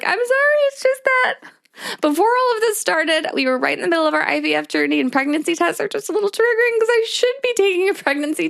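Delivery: high, upset retelling of her own apology; tearful apology. Retold or imitated. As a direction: say it high and near tears, rushing the explanation. Retelling her tearful reply to nurse.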